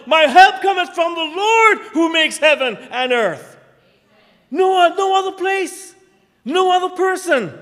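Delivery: loud, high, ringing preacher's call; full-force preaching. Acted. Preacher exhorting the church, loud and rising. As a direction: drive it home to the congregation.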